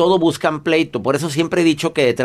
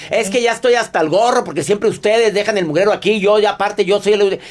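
higher-pitched mock tirade; imitated griping, pitched up; ranting complainer; 6 semitones higher than usual. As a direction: act out a fed-up quarreler going on and on. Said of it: "cuando alguien empieza a hacer bronca".

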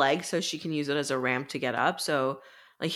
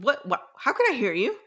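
mock disbelief; 7 semitones higher than usual. Violet stunned the dragon talks.

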